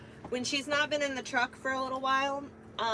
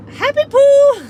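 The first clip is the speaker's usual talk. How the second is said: bursts out loud and high, delighted; excited shout; 20 dB louder, slower than usual. Real, not acted. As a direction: yell it with sudden glee. Reads a funny sticker aloud.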